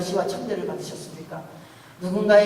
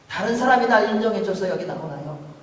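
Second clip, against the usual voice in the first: ordinary talk, nothing marked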